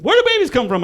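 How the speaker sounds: put-on high child's question; piping little-kid voice. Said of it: "He said".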